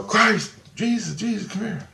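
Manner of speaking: high-pitched call fading off. Retold or imitated. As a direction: call him over from across the room. Calling Christ to the door.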